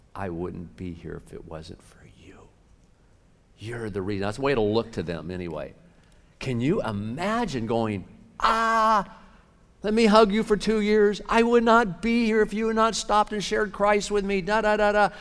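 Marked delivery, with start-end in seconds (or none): 0.1-2.5 whisper. Pretend. Imagined soul thanking in heaven. 8.4-15.1 joyful gushing. Pretend. Imagined grateful soul in heaven.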